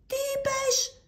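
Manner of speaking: very high, loud asking back; loud question. Acted. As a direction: bark it as if he can't hear. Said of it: "ρωτούσε δυνατά ο μπακάλης". (δυνατό, ψηλό «τι είπες)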